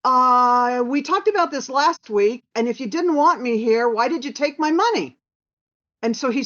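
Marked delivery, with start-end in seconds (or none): 0.0-5.2 smartass comeback. Retold or imitated. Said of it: "I'm a little bit of a smartass".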